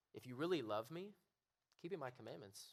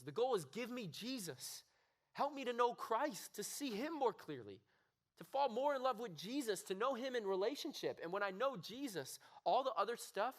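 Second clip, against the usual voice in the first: loud, high urgent preaching; impassioned preaching; 10 semitones higher, 6 dB louder than usual. Real, not acted. Preacher pleading with the room to get it right, loud and urgent. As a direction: plead with the youth at full force.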